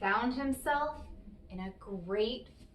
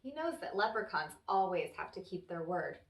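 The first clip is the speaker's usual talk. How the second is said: plain reading voice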